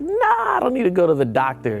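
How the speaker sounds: dismissive. Retold or imitated. Brushing off friend's worry.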